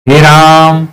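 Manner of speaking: usual speaking voice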